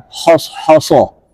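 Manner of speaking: wrong thick "hoso" with full emphatic h; "hoso" said heavy and dark, the wrong way. Shown as a demonstration. Teacher demonstrates the wrong thick reading. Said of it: "Jangan hos-, hoso".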